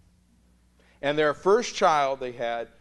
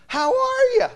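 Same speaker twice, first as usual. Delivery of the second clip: forced cheer; 12 dB louder than usual. Retold or imitated. Laughing, fake cheer.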